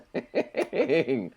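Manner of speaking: high-pitched burst of laughter. Real, not acted. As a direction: crack up at the embarrassment. Laughing at an embarrassing Twitter account.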